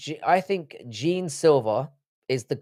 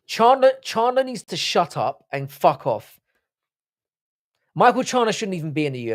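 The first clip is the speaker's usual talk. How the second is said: real upset rant; 4 semitones higher than usual. Real, not acted. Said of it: "Sorry, I'm getting upset now".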